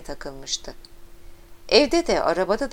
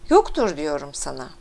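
plain reading voice

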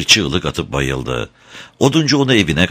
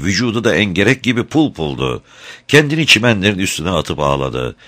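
ordinary delivery, nothing marked

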